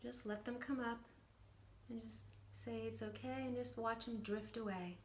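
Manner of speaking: guided meditation. Acted. Coach guiding viewers through a short meditation, soft and calm. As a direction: guide them gently into stillness.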